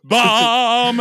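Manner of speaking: loud, high mock computer chime; loud startup chime. Imitating the laptop's startup sound, loud chime. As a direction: boom it out like the computer. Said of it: "And it's like".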